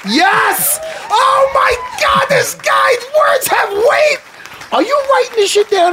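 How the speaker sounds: shouted high, slow, over-the-top cheer; mock hype. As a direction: roar it like a fan going wild.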